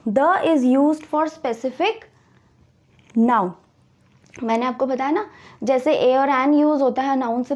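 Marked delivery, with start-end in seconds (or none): 0.1-3.5 very slow, every word spaced; slow dictation. Shown as a demonstration. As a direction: dictate the rule to the class. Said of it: "teacher repeats the rule".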